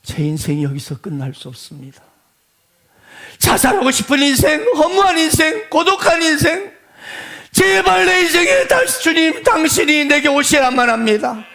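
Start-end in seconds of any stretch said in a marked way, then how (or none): 3.4-11.4 loud, raised preaching plea; fervent pleading prayer. Acted. Beggar pleading with Jesus for his life, loud fervent plea. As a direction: beg the Lord with full force. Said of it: "간청했습니다".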